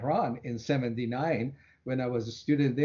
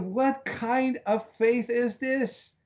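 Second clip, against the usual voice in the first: exasperated plea; 8 semitones higher than usual. Retold or imitated. Retold exasperated prayer.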